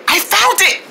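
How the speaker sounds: high-pitched burst of triumph; thrilled, high exclamation; excited discovery. Acted. Discovers the hidden quarter.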